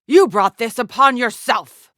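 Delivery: loud, high-pitched blame; angry accusation. Acted. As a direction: throw it at the opponent in battle. Battle threat to an opponent.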